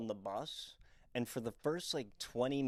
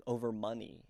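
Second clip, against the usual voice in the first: ordinary talk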